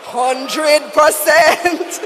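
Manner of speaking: drawn out slowly, laughing; laughing punchline. Real, not acted. Emcee delivering joke punchline while laughing. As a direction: savor the punchline through laughs.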